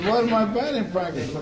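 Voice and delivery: high-pitched mock outrage; acted indignation. Pitcher objecting to his coach, acted indignation. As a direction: throw back an incredulous question.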